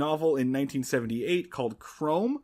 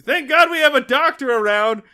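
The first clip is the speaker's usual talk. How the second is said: mock relieved dad; 10 semitones higher, 8 dB louder than usual. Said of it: "voicing Andy's reply".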